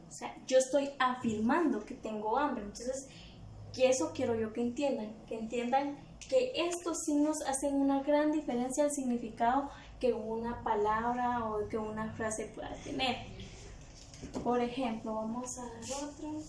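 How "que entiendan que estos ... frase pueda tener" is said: ordinary delivery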